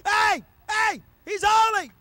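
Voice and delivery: high, loud, excited shouting; an angel who can't believe what he sees; one angel yelling wonder to another; awed angel shouting. Acted. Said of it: "They're like".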